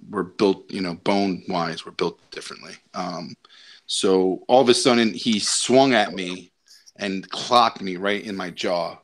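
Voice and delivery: ordinary talk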